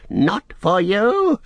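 high, drawn-out defiant cry; Gollum crying out. Acted. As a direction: cry it slowly, rising high. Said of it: "he cried".

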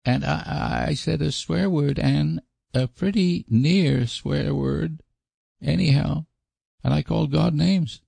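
put-on high child's voice, held high throughout; high-pitched sing-along of a child's guilty list; Davy, confessing little boy. Boy pours out his confession.

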